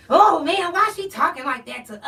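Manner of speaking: loud, high mock complaint; mock outraged whine.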